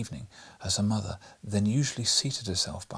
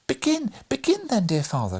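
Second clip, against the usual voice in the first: put-on much higher, louder children's plea; eager cry; 13 semitones higher, 5 dB louder than usual. A narrator's reading. Children beg for the story with an eager cry. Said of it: "cried the children in unison".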